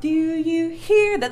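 "hear" stressed loud and slow, a silly wrong beat; wrong accent. Shown as a demonstration. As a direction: sing "Do you hear that?" with the accent comically misplaced. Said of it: "That just sounds ridiculous".

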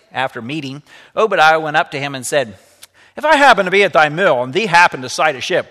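lively read-aloud storytelling voice; story narration. A narrator's reading. Teacher reading a story to the class, lively read-aloud. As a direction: bring the story alive for the students.

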